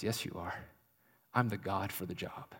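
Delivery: quiet reassurance. Acted. God voiced quietly reassuring.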